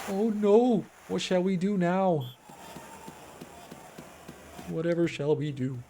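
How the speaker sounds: lightly posh mock panic; mock dismay. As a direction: fake a damsel's alarm. Mock panic at conceded goal.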